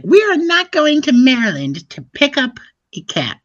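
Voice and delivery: high, loud mock wife; terrible wife voice. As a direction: put on a bad high-pitched impression of an indignant wife. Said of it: "That is a terrible voice for my wife".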